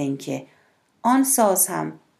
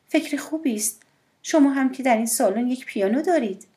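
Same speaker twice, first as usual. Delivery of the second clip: higher, lively woman's voice; voiced woman, higher and light; woman, playful; 6 semitones higher than usual. A narrator's reading. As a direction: lift her voice high, teasing.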